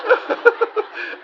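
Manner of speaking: high airy giggle; laugh. Acted. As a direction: giggle at the two guests. Laughs at the bickering.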